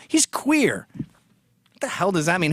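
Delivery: ordinary delivery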